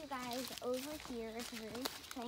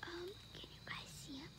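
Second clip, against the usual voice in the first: whispering; 5 semitones higher, 9 dB quieter than usual. Real, not acted. Whispering near a deer.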